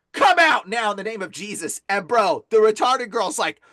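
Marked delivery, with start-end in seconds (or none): 0.1-1.8 forceful exorcist's order, hit hard at the start; re-enacted command. Exorcist orders demon out in church, forceful command. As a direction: command the demon out, firm and loud at first.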